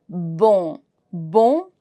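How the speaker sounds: Bon as a nasal vowel, N unsounded; silent N. Shown as a demonstration. Teacher repeats the word for learners, keeping N silent. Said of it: "N is silent for now".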